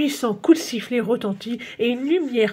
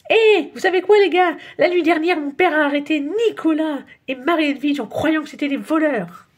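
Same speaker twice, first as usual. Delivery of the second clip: much higher and louder, eager; excited news; 7 semitones higher, 5 dB louder than usual. A narrator's reading. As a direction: burst in with the big news. Rufus rushes in excited.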